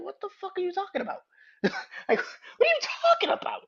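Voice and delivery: high-pitched disbelief; incredulous squeal. Real, not acted. Mocking disbelief at lyrics.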